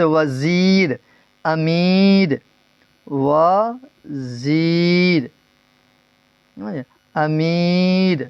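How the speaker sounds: وزیر and امیر with the "ee" stretched slowly; drawn-out long ee. Shown as a demonstration. Teacher gives examples of ya-e-maroof, وزیر and امیر with drawn-out ee. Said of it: "جو کھینچ کر پڑھی جائے".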